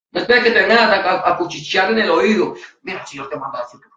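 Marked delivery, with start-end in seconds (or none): 2.8-3.9 hushed quick murmur, softer; a quick hushed secret; mock whisper. Imagined whisper in the ear.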